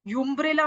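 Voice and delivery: यूम्ब्रेला starting with "yoo" in place of "uh"; wrong pronunciation. Shown as a demonstration. Teacher warns class off a mistake, यूम्ब्रेला begun with "yoo". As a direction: put a "yoo" on the front of यूम्ब्रेला. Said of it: "भी नहीं बोलोगे".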